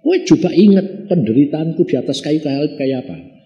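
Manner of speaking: ordinary delivery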